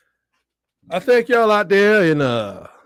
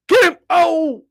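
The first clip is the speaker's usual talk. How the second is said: high, loud shout at the play; real yell; 10 semitones higher, 5 dB louder, slower than usual. Real, not acted. Fan yelling at defenders, real shout. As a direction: yell it sharply, pitch way up.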